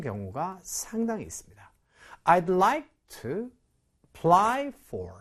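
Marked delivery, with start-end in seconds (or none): 2.2-5.1 very slow, word by word, "apply" with its weak A; slow demo reading. Shown as a demonstration. Teacher modelling the phrase slowly for students, slow and careful. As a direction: spell out I'd like to apply for slowly. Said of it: "이렇게 발음을 보통 하거든요".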